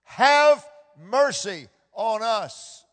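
loud, slow plea for mercy; pleading cry. Acted. Lepers begging God for mercy, slow loud plea. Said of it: "cast themselves on the mercy".

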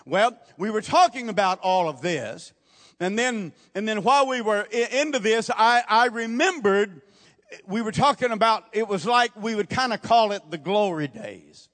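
lively preaching. Acted. Preacher recalling glory days to the congregation, animated and loud. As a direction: preach the memory up with energy.

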